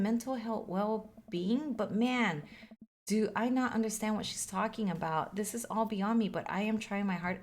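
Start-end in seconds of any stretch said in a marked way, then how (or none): none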